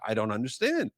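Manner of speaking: ordinary talk, nothing marked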